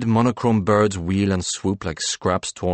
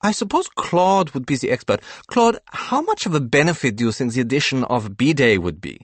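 higher, quick character voice; Ish's voice; 7 semitones higher, faster than usual. A narrator's reading. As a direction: Ish asking Claude innocently, in a higher voice. Ish voiced in read story.

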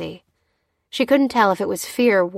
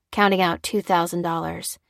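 ordinary delivery

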